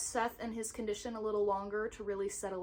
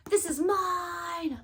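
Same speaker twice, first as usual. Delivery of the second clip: slow, louder and higher claim with the weight on this; a playful, hammed-up possessive cry; mock possessive shout; 6 semitones higher, 3 dB louder, much slower than usual. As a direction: shout it like guarding a treasure. Jokingly wants to gatekeep the book.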